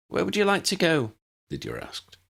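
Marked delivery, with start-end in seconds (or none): 0.1-1.1 put-on girl's voice. Lydia voiced higher.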